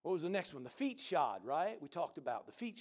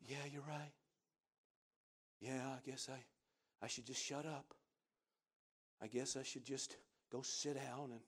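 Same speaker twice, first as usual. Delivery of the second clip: mock defeated mumble, much softer and lower; defeated believer; 5 semitones lower, 12 dB quieter than usual. Discouraged believer agreeing with the devil, soft defeated mumble. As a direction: give up in a small, beaten voice. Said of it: "a lot of people go".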